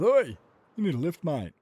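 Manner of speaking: high, loud call from a car; a driver offering a boy a lift, calling out loudly; stranger calling out. Retold or imitated. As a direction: shout over to a kid on the roadside. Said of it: "he goes".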